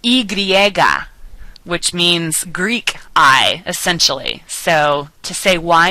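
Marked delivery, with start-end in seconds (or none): 0.0-1.1 Y griega said slowly as "ee gree-EH-ga"; Spanish letter name. Shown as a demonstration. Teacher showing another name for the letter, slow Spanish pronunciation. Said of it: "can also be pronounced".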